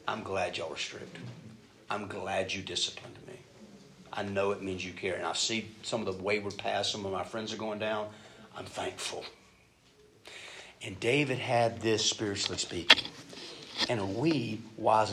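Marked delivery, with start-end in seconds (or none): 0.1-9.2 soft, lower grateful words; a grown son thanking his parents, heartfelt; sincere thanks. Retold or imitated. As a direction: say it quietly and sincerely.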